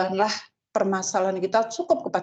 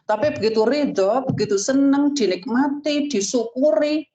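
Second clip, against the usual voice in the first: rising higher with each word, praising gratitude; building preaching; 5 semitones higher than usual. Acted. Preacher urging the teachers toward gratitude, rising higher each word.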